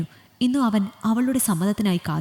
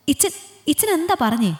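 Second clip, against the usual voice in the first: stunned disbelief; 7 semitones higher than usual. Acted. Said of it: "ഒരു നിമിഷത്തെ മരവിപ്പിന് ശേഷം".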